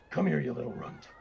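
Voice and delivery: lightly voiced booming bully; a hint of a big, booming voice. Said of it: "it's a really loud, booming voice".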